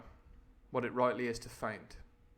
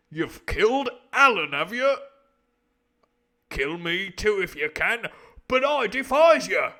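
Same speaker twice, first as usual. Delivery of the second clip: high, booming accusation; defiant sailor; 5 semitones higher, 11 dB louder than usual. Acted. Sailor accusing a killer, loudly outraged. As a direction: throw the charge out loud and high.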